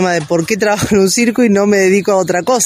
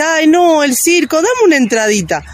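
higher, wheedling mock voice; mock pleading customer; 8 semitones higher than usual. Circus performer mimics people begging for free tickets, higher wheedling mock voice. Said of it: "a ver, te dice".

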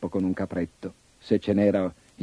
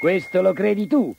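higher snorting billy goat; scoffing big goat; 9 semitones higher than usual. Acted. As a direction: scoff defiantly. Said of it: "sbuffò il caprone".

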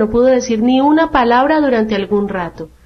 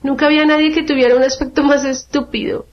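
higher voice, cutting in abruptly; sudden interjection; 5 semitones higher than usual. A narrator's reading. A silent violet suddenly insults Alicia, abrupt and higher. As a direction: burst in unexpectedly, pitched higher. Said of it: "dijo una violeta de una manera tan súbita".

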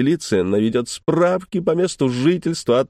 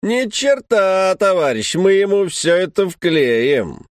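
shouting; 8 semitones higher, slower than usual. A narrator's reading. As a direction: shout it right in his face. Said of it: "крикнула билетёрша в лицо французу".

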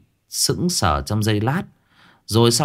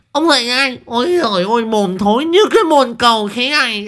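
much higher, louder shrill scolding; furious wife's screech; 5 dB louder than usual. A narrator's reading. Wife screaming at her drooling husband to wake up, furious screech. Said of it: "một tiếng rít đầy phẫn uất".

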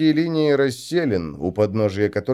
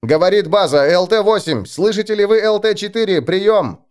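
higher, louder radio call; 8 semitones higher, 4 dB louder than usual. Acted. Base calls pilot by radio.

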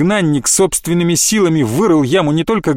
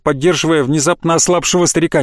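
usual speaking voice

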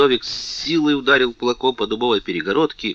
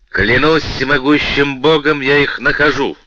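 higher and louder, a fierce vow; vengeful oath; 6 semitones higher, 7 dB louder than usual. A narrator's reading. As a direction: swear it like a war cry. King swears revenge.

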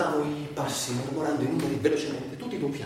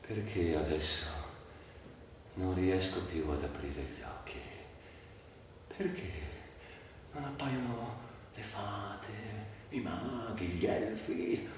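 much softer, sinking lament; fading despair; 7 semitones lower, 11 dB quieter than usual. Acted. A paralysed man asks why the magic is gone, fading to near silence. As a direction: let the voice drain away almost to nothing. Grieving man losing his body.

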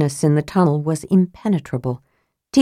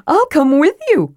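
eager Tass; 11 semitones higher, 4 dB louder than usual. Acted.

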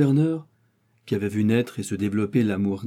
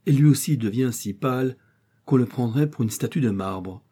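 ordinary delivery, nothing marked

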